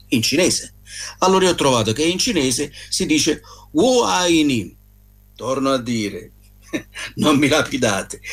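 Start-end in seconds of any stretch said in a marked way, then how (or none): 3.7-4.8 wo ai ni very slow and a bit higher; Chinese phrase. Shown as a demonstration. Speaker attempts Mandarin for listeners, slow careful. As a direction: spell out wo ai ni word by word. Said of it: "la mia pronuncia in queste lingue sicuramente è terrificante".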